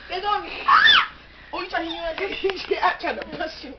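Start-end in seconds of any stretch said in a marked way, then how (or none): 0.7-1.1 sudden yelp. Real, not acted. Sudden outcry while roughhousing.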